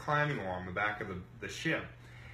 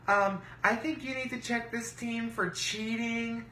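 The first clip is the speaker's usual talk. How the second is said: high-pitched mocking imitation; mimicked tattling rival; 11 semitones higher than usual. Rival accusing the team of cheating, high mimicking voice.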